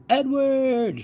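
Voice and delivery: high, loud call of a name; a girl's high calling shout. A narrator's reading. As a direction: call out for Edward across the house. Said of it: "she shouted".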